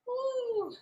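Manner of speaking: sudden high whoop of encouragement; high cheering woo. Real, not acted. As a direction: cheer them on with a bright woo. Cheering a student's balance.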